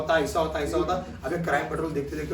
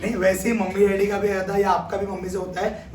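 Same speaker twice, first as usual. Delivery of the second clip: usual speaking voice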